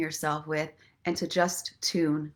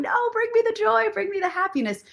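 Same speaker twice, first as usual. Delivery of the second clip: high, pleading mock voice; pleading flowers; 13 semitones higher, faster than usual. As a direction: beg in a squeaky flower voice. Flowers begging to stay.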